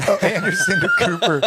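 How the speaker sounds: amused, laughing high. Real, not acted. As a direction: laugh through the name. Laughs at the Anderson Cooper idea.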